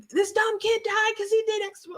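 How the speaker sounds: mock a stern grandmother; imitating grandmother. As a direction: grandmother warning his dad, high scolding. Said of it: "and she's like".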